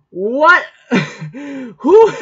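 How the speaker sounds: high, loud startled what; sudden high loud disbelief; stunned disbelief. Real, not acted. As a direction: blurt it out in shock, then laugh. Reacting in disbelief, laughing.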